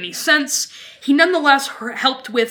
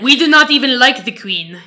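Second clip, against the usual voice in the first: louder, hammed-up European French accent; bad French accent; 5 dB louder than usual. Speaking as anti-war French Canadians, badly faked French accent. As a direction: put on a wrong-side-of-the-pond French accent. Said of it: "that's not the right accent".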